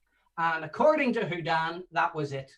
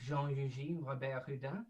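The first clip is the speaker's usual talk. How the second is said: "Jean-Eugène Robert Houdin" spelled out slowly and softer; slow, softer "Jean-Eugène Robert Houdin" so everyone can follow; 4 semitones lower, 9 dB quieter than usual. Shown as a demonstration. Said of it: "I'll say it slowly for you".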